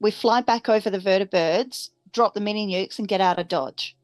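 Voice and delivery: usual speaking voice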